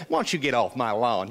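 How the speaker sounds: friendly Southern put-on voice; Southern accent. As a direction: imitate a nice Southern gentleman's accent. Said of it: "he has this wonderful Southern accent".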